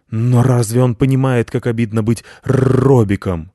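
loud aggrieved complaint, growled R; indignant guard dog; offended dog. Acted. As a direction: complain with wounded pride. Offended dog complains about nickname.

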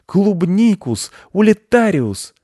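high, drawn-out magic incantation; loud spell cry. A narrator's reading. Boy wizard casting a spell on strawberries, loud cry. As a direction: cry the spell out slowly and loudly. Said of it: "и громко закричал".

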